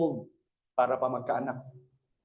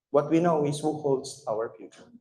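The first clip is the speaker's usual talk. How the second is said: ordinary talk, nothing marked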